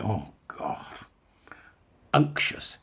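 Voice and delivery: ordinary talk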